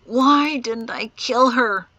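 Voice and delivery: slow, loud anguished whimper; drawn-out, frustrated lament. A narrator's reading. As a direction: wail it slowly in self-reproach. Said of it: "he whimpered".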